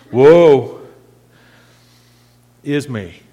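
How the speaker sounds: 'Woe' punched loud and drawn out; heavy emphasis on woe. Acted. Preacher dramatizing Isaiah's cry, stressed woe. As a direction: hit 'Woe' hard, then let 'is me' drop. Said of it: "I put a little different emphasis on that".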